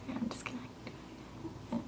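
breathy whisper under her breath. Real, not acted. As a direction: whisper so the cat stays calm. Quietly moving the cat.

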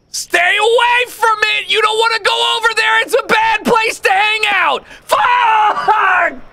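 high shouted pleading at the ball; shouting. Real, not acted. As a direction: yell at it to stay away. Yelling at rolling ball.

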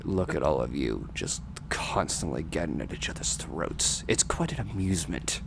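low, soft, menacing villain; scary villain voice. Acted. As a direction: purr it out like a smug villain. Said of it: "Doctor loves scary villain boys".

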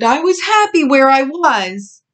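high, loud complaining whine; whining. A narrator's reading. Little pig Bully missing his old home, whining. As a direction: whine like an unhappy little one. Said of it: "Bully whined".

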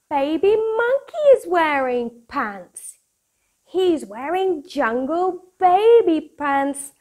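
bright, bouncy storybook read-aloud; lively picture book voice for kids; reading to children. Acted. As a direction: read it like bedtime story time for little ones.

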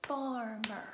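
"Farmer" loud, two heavy beats FAR-MER; pounded-out syllables. Shown as a demonstration. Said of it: "Let's pound it".

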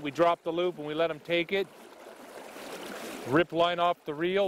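ordinary delivery

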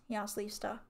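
ordinary delivery, nothing marked

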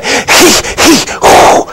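high, strained hee-haw of a cry; mean-faced Mary. Acted. Mary reacting to the offer of a barn, strained bray. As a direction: wail it high like a donkey's bray. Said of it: "contorted look upon her face".

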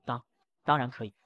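cowed, halting agreement, lightly acted; tearful stammer. A narrator's reading. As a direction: give in with a small stammer. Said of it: "被折磨的都哭了".

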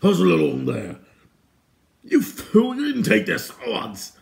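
high, loud furious bark; irate guard's yell; angry shouting. Acted. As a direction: bellow angrily at a bungling underling. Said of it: "angry voices shouted at him and at each other".

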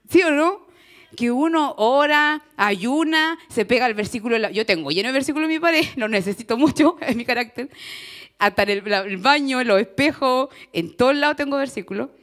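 loud, laughing, fired-up confession; excited sermon burst; fervent preaching. Real, not acted. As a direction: pour it out loud to the congregation.

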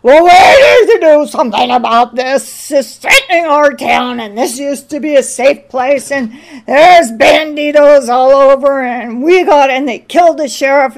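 loud, blustering small-town mayor; mayor hammering on at a town hall meeting, pompous and loud; pontificating mayor. Retold or imitated. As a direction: hold forth like a pompous politician. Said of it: "the mayor gets up and begins to pontificate".

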